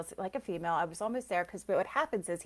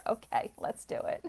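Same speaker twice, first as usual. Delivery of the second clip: whispered giving in. Retold or imitated. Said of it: "you're like".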